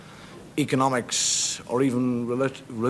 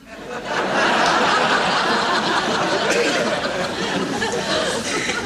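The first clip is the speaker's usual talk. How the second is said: long high cackle; long high laugh; 5 dB louder than usual. Real, not acted. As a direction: let a long shrill laugh roll. Laugh at own impression.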